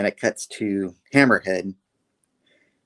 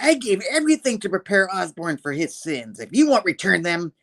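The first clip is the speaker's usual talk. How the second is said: higher-pitched theatrical threat; acted villain speech; 8 semitones higher than usual. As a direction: declaim the grievance in a raised character voice. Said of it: "She's like".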